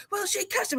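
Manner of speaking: high, soft whine; whiny tattling helper. Retold or imitated. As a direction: tattle like a sulky kid. Imitating helper whining to boss.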